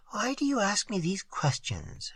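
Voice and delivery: soft, murmured question. A narrator's reading. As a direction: murmur it softly. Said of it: "she murmured".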